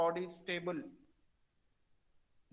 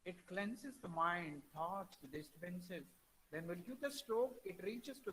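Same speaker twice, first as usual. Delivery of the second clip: barely audible; 10 dB quieter than usual. Real, not acted. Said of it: "Sir, we can't able to hear".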